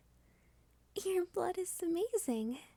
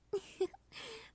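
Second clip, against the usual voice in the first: a short rising, playful laugh; amused laugh; 6 semitones higher, 3 dB quieter than usual. Acted. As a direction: laugh as you show off. About to lift the couch.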